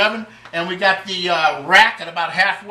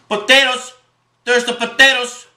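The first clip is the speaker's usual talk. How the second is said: put-on Irish; 5 semitones higher than usual. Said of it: "this is for my Irish buddies".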